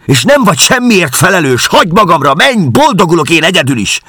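magas, hangos, kétségbeesett kiabálás; dühösen elküldi a férfit, torkaszakadtából; kiáltás. A narrator's reading. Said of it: "Kiáltott fel hirtelen".

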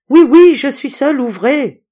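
high, very loud urgent reply; exasperated shout. A narrator's reading. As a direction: shout impatiently through the door. Doctor answering through locked door.

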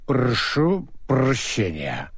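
put-on slow, slurred drunken apology; tongue tangled with drink; slurred drunk speech. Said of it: "заплетающимся языком проговорил он".

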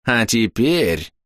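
rough, hard, smug, slow; coarse smug voice. A narrator's reading. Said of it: "голос его изменился, стал грубым, жёстким, самодовольным". (грубый самодовольный голос)

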